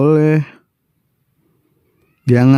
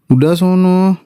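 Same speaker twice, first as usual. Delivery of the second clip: huffy shoo; 6 semitones higher than usual. Acted. Sulky wife shoos husband.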